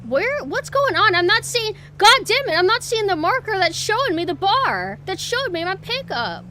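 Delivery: loud, high, exasperated yelling at the game; frustrated outburst. Real, not acted. Streamer yelling at her delivery game, frustrated and loud. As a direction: snap at the screen in real frustration.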